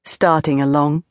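usual speaking voice

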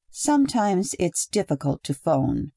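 plain reading voice

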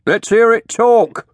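high, loud heckle, drawn out; calling out. A narrator's reading. Someone in the crowd demanding talk, calling out. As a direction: shout it from the crowd. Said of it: "someone called out".

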